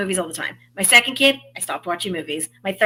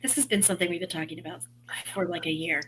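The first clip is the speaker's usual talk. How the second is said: whispering; 4 semitones lower, 12 dB quieter than usual. Real, not acted. Aside about the subscription.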